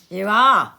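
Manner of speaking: loud, pointed echo; mock stern. Scrooge echoing Bob's excuse, loud mock sternness. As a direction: throw his words back at him. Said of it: "repeated Scrooge".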